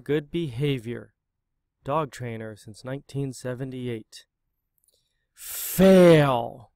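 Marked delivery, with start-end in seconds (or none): 5.3-6.7 loud barked verdict; a loud, harsh shout of fail. Acted. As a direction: bellow it like a stern judge. Stamp on certificate, shouted.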